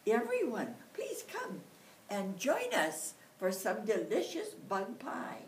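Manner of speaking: calling out. Acted. Mother inviting everyone.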